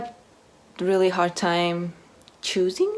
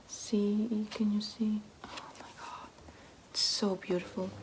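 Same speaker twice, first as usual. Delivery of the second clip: soft, breathless whisper of admiration; awed whisper; 2 semitones higher, 12 dB quieter than usual. Real, not acted. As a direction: whisper in awe over a pretty cover. Admiring the book cover on camera.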